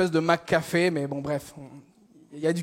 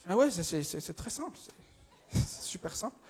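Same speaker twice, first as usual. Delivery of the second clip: very soft, fading stammer; faint stammer; 2 semitones lower, 10 dB quieter than usual. Acted. Lost cashier pretends it is clear, faint stammer. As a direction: trail into a near-whisper, pretending to understand. Cashier feigns having understood.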